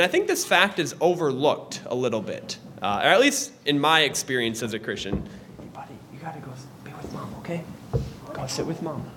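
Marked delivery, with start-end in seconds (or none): 5.6-9.1 hushed word to the son mid-lesson. Real, not acted. Aside sending his child to mom.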